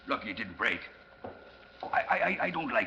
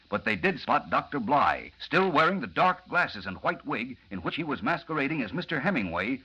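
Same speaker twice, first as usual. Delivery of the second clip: announcer recap voice; radio drama narration; 2 semitones lower than usual. A narrator's reading. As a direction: bring listeners back into the serial.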